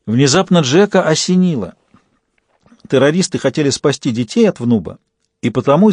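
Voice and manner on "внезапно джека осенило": ordinary delivery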